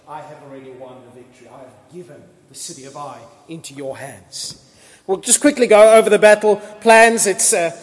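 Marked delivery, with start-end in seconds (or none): 0.1-4.6 God's quiet assurance. Retold or imitated. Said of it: "God says to Joshua".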